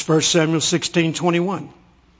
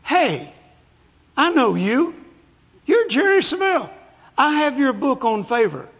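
lady's high-pitched voice greeting someone famous; excited lady fan; 7 semitones higher than usual. Retold or imitated. Airline lady spots the preacher at the counter, high and excited. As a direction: call it out high, recognizing a celebrity. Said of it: "Another lady will come out".

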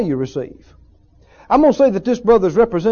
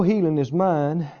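lower, softer, trailing off weakly; mildly acted weak believer, quieter and lower; weak faltering; 5 semitones lower, 6 dB quieter, slower than usual. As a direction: sound too weak to finish the thought. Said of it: "They're so weak".

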